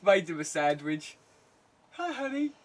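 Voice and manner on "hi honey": imitating the wife. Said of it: "she comes down".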